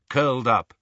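usual speaking voice

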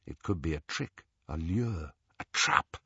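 low, hushed warning; cautious murmur. A narrator's reading. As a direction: murmur it softly, sinking almost to a whisper. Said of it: "Caution murmured".

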